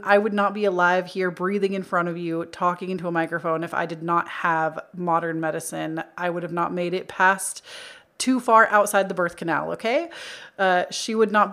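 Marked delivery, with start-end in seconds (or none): none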